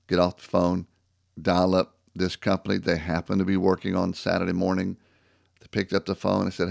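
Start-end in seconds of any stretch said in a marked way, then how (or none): none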